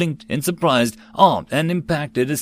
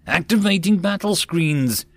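excited yell; 7 semitones higher than usual. A narrator's reading. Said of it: "Tak yelled".

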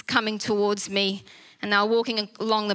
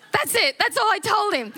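laughing; 9 semitones higher than usual. Real, not acted.

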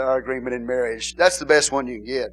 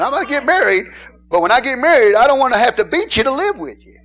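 mock-earnest vow; 10 semitones higher, 9 dB louder than usual. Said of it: "voicing a young man's vow".

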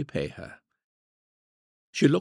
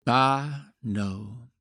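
sighing; 2 semitones higher than usual. A narrator's reading. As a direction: let the words out on a sigh. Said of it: "sighed Olivia".

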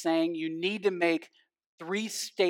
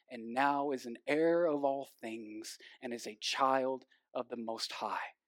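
much softer and slower, sincere and reverent; tender and hushed; 4 semitones lower, 4 dB quieter than usual. Real, not acted. Preacher to his congregation about how God sees them, tender and hushed. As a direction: let it sink in gently, almost in awe. Tender description of God's love.

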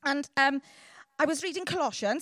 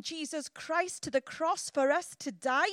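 ordinary talk, nothing marked

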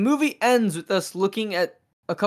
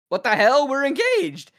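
mock outrage rising high on the last words; outraged retort; 8 semitones higher than usual. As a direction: imitate an indignant fiancée, pitch shooting up. Acting out Loretta's outraged protest.

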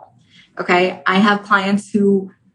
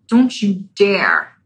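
slow warning; much slower than usual. Acted. Ego clinging, drawn out.

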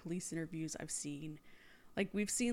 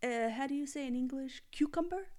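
faked foreign accent, rising pitch on the last word; Hilaria Baldwin; 5 semitones higher than usual. Host mocks a faked accent, put-on foreign English. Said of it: "pulled a Hilaria Baldwin".

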